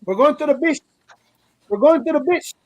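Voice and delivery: put-on high-pitched Cuban-accented stepmom. Cuban stepmom telling kids about the beach, high Cuban accent. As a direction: impersonate an insistent Cuban woman, pitched well up. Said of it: "she sounds like a female Scarface".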